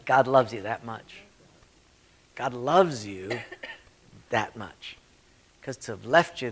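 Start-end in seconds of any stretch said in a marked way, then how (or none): none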